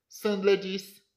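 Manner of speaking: ordinary delivery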